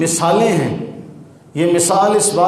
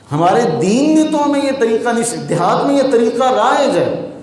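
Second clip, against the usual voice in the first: usual speaking voice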